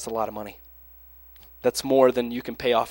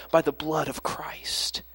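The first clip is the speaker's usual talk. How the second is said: whisper; 7 dB quieter, slower than usual. Real, not acted. Sermon climax, hushed.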